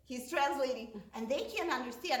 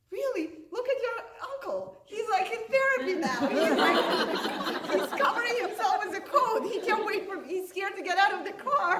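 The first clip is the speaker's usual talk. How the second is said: high, loud and fast through giggles; giggling high-pitched jab about the uncle; laughing mock retort; 10 semitones higher, 8 dB louder than usual. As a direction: tease him while cracking up.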